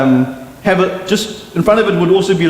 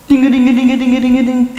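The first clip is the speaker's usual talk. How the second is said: fast, higher jingling ding-a-ding, imitating coins; coins rattling down; 8 semitones higher, faster than usual. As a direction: rattle it off like coins falling down a copper pipe. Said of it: "you would hear it going".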